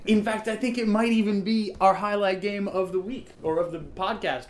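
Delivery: big hyped-up show host intro; announcer voice. Pretend. Hyping the podcast's featured game.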